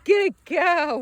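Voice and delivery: high, loud, drawn-out encouragement; excited praise. Real, not acted. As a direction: call the dog in with praise. Calling and praising dog.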